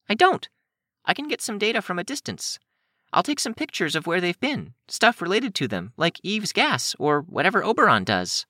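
put-on high, earnest girl voice; high-pitched, insistent explaining; eager Kenzie. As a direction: make the case in a high young voice.

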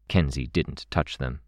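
ordinary delivery, nothing marked

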